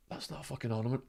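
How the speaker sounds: stunned aside. Retold or imitated.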